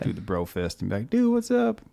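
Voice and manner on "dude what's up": high, bright call of "dude"; excited greeting. Retold or imitated. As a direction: greet him like a bro. Retold bro fist greeting.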